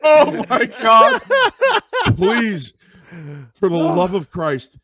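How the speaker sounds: loud high begging, laughing disbelief; excited pleading. Real, not acted. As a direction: beg them, overwhelmed with delight. Begging the host to keep clip.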